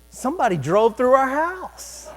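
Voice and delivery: mock disbelief at a crash; retold alarm. Startled thought on hearing screaming.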